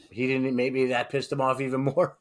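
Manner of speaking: normal talking voice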